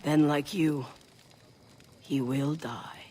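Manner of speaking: breathless ominous whisper; whispered threat. Acted. Clan mother warns the mother about her son, whispered. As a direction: let the doom hang in the air.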